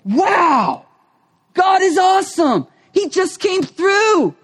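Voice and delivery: high, loud cry of wonder; overjoyed cry of thanks; amazed praise. Retold or imitated. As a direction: shout it out like a prayer answered. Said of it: "and you go".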